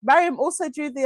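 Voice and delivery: ordinary talk, nothing marked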